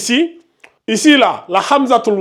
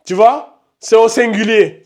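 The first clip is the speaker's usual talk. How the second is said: ordinary talk, nothing marked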